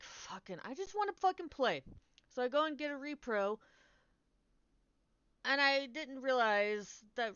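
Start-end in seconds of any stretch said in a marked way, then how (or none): 0.0-1.8 exasperated gamer whine; frustrated outburst. Retold or imitated. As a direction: pitch jumps high on the complaint. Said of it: "So I was like".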